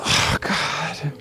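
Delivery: fed-up sigh of a man at his wit's end; exasperated groan. Retold or imitated. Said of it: "he was at his wit's end".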